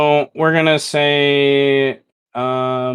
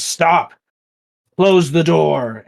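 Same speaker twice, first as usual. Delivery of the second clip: higher and louder, commanding; shouted order; 6 semitones higher, 6 dB louder, slower than usual. Acted. Mel shouts at the group by the door, commanding. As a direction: bark it across the room.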